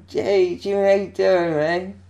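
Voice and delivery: very high, loud mock-drunk hail; drunken greeting. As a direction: gush at your idol like a happy drunk. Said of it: "Jimmy's drunk as well".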